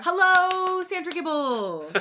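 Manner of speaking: high, singsong hello drawn out; shout-out greeting. Pretend. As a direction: greet her in a high, stretched-out voice. Answering a shout-out to Sandra.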